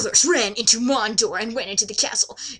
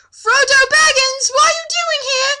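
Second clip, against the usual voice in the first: much higher, louder, rushed startled squeal; mock astonishment; 13 semitones higher, 5 dB louder than usual. Reading Gandalf's surprise at Frodo, high and squealing. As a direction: shriek it like a flustered old wizard.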